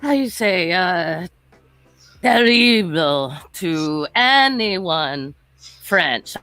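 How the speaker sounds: slow, hammed-up French accent; English in a stagey, drawn-out French accent. Shown as a demonstration. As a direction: put on a fake French accent, drawing words out. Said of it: "English can you do in a French accent".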